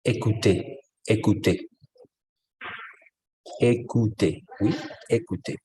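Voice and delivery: "écoutez" modelled for a learner, falling at the end; French "écoutez", ending on a clear "tay" sound; pronunciation demo. Shown as a demonstration. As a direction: say "écoutez" as separate, careful French examples. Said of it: "cómo se pronuncia escuchar?".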